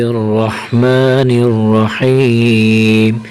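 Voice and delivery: slow, drawn-out recited basmala; slow devotional recitation; Quranic recitation. Acted. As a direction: intone it slowly like opening a recitation. Opens lesson with recited basmala.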